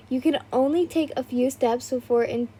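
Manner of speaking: ordinary delivery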